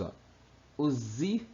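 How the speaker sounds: ordinary delivery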